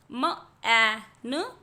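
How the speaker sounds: म ए न, each sound split apart and slow; sounding out letters. Shown as a demonstration. Teacher sounding out a word for children, slow and split. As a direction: stretch म ए न into separate sounds. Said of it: "तो यहाँ पर क एट".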